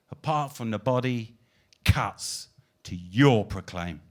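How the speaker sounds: declaimed spoken-word poem; poetry recital. Acted. Poet closing the night with a recited poem, declaiming. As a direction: proclaim each line to the room.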